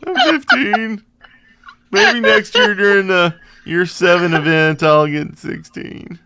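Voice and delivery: high, smug, taunting voice; gloating fake sympathy. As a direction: taunt them with fake pity. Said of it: "mocking the other's level".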